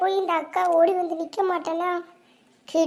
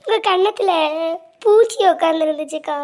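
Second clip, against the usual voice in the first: ordinary talk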